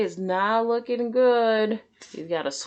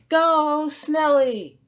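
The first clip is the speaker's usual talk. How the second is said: high, loud cheer; root hard for your snail; 7 semitones higher, 5 dB louder than usual. Acted. Squidward cheering Snelly from the sidelines, loud excited cheer. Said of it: "cheered Squidward".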